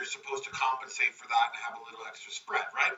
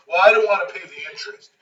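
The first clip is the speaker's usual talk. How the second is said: louder, defensive; mock defensive excuse; 4 semitones higher, 11 dB louder than usual. Builder explaining himself to Pete, louder defensive. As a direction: blurt the excuse.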